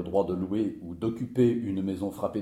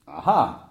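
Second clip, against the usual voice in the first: "Ah ah" louder, second syllable punched; emphatic, knowing "Ah ah"; "Ah ah" in major; 6 semitones higher, 6 dB louder than usual. Acted. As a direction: sing out "Ah ah" triumphantly, loud on the last "ah". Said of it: "Oui, mais en majeur".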